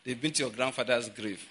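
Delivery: ordinary talk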